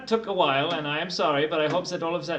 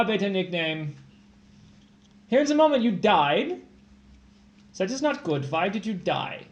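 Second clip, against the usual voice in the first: normal talking voice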